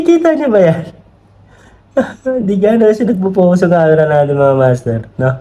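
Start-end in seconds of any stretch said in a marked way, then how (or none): 2.0-5.3 high, bubbling laugh through the words; can't stop laughing at the comments. Real, not acted. (tumatawa habang nagsasalita)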